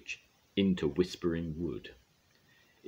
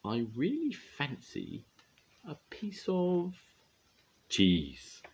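high, slow squeaky mouse; mousy voice; 9 semitones higher, slower than usual. A narrator's reading. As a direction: nibble the words like a mouse. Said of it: "twitching her nose".

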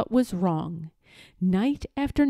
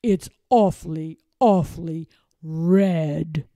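sly villain; 5 semitones lower than usual. Acted. Lord lying slyly.

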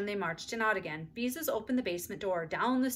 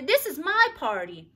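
insistent; 8 semitones higher, 9 dB louder, slower than usual. A narrator's reading. Ramona insisting.

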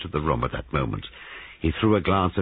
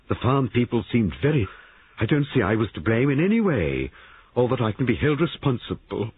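higher, defensive, then petering out; trailing off; 8 semitones higher, 3 dB louder than usual. A narrator's reading. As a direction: protest blame and falter at the end. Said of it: "Her voice trailed off".

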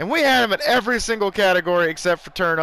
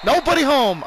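excited shout; 8 semitones higher, 6 dB louder than usual. Real, not acted. Big play, nobody there.

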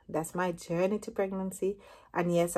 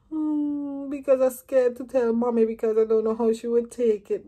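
retold tearful plea; 5 semitones higher, 5 dB louder than usual. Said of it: "so I started crying now".

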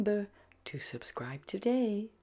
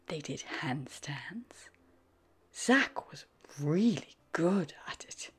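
gentle whispered narration; whispered storytelling; 4 semitones lower than usual. A narrator's reading.

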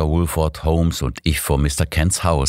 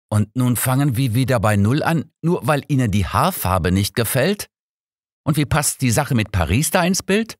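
higher, blustering outburst; 7 semitones higher than usual. Acted. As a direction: rant at Holmes, mildly worked up. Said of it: "polterte Inspektor Woolford los".

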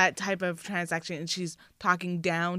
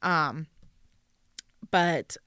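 normal talking voice

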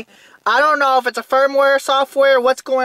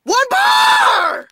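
higher, wilder shout, fully losing it; ranting scream; 8 semitones higher than usual. Real, not acted. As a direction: scream it even higher the second time. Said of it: "But I'm gonna rant".

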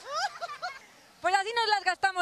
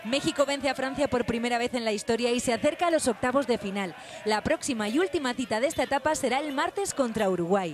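news voiceover; 7 semitones lower than usual. A narrator's reading. Reporter narrating the match for viewers, news read.

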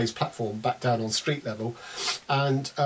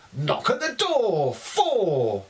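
higher bingo hall call; bingo caller; 6 semitones higher, much slower than usual. Pretend. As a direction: call the number across the hall. Bingo calls in poem.

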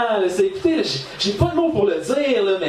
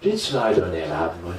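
deadpan retort; 13 semitones lower than usual. Retold or imitated. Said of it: "je leur réponds".

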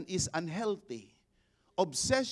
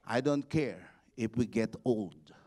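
ordinary talk

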